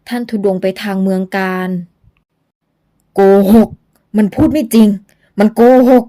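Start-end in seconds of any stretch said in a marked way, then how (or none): 3.1-6.0 loud rasping ghost voice bursting out; a hoarse spirit cutting in, loud; hoarse ghost blurting. Acted. Old ghost blurts out to stop Luang, loud and rasping. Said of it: "เสียงแหบพร่าโพล่งขึ้น".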